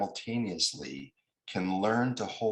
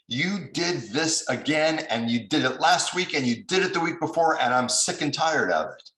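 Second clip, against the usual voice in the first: loud, much higher, rising exasperated rant; angry complaint; 8 semitones higher, 6 dB louder than usual. Retold or imitated. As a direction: pile on the grievances, fed up. Voicing angry party's complaint.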